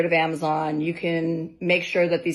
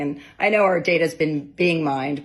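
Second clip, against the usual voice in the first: ordinary talk, nothing marked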